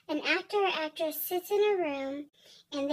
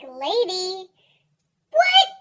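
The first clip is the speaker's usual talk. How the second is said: incredulous, rising to a high shout; mock outrage; 4 semitones higher, 12 dB louder, much slower than usual. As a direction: blurt it out in loud, hammed-up disbelief. Acts indignant reaction to "lady.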